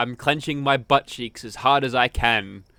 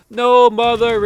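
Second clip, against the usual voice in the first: calling back; 8 semitones higher, 9 dB louder than usual. Acted. Skinner calls back to mother.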